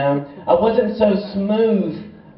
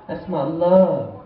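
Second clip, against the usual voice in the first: slow smooth line; slower than usual. Retold or imitated.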